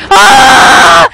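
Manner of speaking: sudden frightened shout; scared scream. Real, not acted. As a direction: yelp in real fright. Jump scare in horror game.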